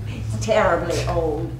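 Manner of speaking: ordinary talk, nothing marked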